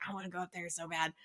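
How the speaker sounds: soft, fast, longing mutter; hushed eager rush. Retold or imitated. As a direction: rush it under your breath like a secret craving. Louise eager, retold quote.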